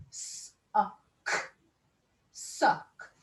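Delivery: "Sock" split into sounds, whispered; sounding out. Shown as a demonstration. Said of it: "Let's sound out sock".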